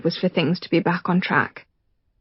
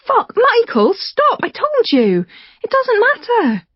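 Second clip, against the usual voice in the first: much higher, pleading woman's voice; Rachel distressed. Acted. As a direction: beg him to stop, high and desperate. Rachel pleads as books fly.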